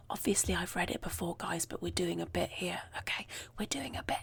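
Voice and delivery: whispered secret to the camera; whispered aside. Real, not acted. She admits to viewers she's faking surprise, whispering. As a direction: lean in and confide it under your breath.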